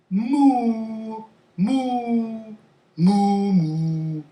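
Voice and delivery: long, drawn-out level moos; cow moo. Shown as a demonstration. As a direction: moo like a cow for a guessing game. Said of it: "I will make the sound".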